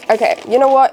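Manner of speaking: ordinary delivery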